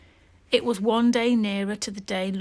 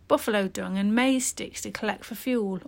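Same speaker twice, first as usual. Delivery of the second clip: ordinary delivery, nothing marked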